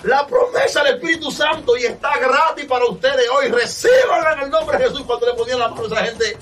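high, loud revival shout easing slightly; impassioned preacher proclaiming power; fervent preaching. Acted. Preaches at full force.

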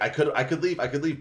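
loud, high pleading cry; torn outburst. Retold or imitated. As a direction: blurt it out, wrestling the urge. Marco torn, quoted loudly.

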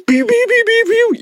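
high-pitched beep-beep like a reversing truck. Pretend. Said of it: "look at this".

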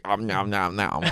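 om nom eating noise, quiet; hungry chomping. Pretend. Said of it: "sound effects. He's hungry".

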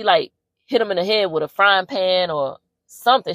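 ordinary talk

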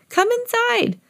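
very high sing-out invitation; high grandmotherly welcome; Granny Pig. A narrator's reading. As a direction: call the grandchildren in, pitch way up. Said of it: "answered Granny Pig".

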